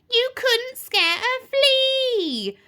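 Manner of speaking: high, slow, drawn-out witchy jeer; scornful. A narrator's reading. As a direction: scoff with a long, high last word. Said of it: "snorted Spell".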